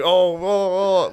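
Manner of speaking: high, drawn-out teasing oohs; mock him playfully, slow and high; joking taunt. Comic jokingly gets in his friend's face, high teasing. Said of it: "like, joking".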